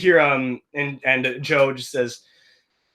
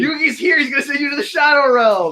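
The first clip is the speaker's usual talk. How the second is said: much higher, hammed-up anime hype; mock hype; 11 semitones higher, 4 dB louder than usual. Players joke about Yugi, high mock hype. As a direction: ham it up like a card-game fan.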